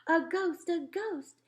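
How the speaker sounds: high, loud cry of alarm; acted panic, pitched well up; panicked yelling. A narrator's reading. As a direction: cry it out high like a frightened crowd. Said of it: "with everyone yelling".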